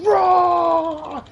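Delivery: high loud roar; mock scream. Retelling the screaming fighter, mock battle roar. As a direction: belt out a battle cry.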